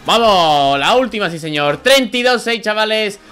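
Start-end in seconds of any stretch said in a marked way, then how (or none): 1.8-3.2 high, lively host voice; upbeat streamer announcement. Acted. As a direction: sell it to the viewers. Upbeat channel host outro. (presentador animado de canal)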